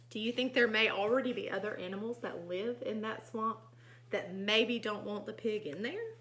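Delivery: ordinary delivery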